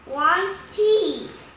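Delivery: ordinary delivery, nothing marked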